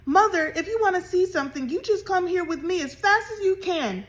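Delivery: high, loud and fast; excited cry. A narrator's reading. Hetty fetching her mother, excitedly calling. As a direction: call out breathless with news. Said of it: "she cried".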